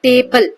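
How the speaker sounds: Table broken slowly into टे and बल; two separate beats in table, with a gap before बल; split into syllables. Shown as a demonstration. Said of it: "देखो दो मात्राएं आ गई ना".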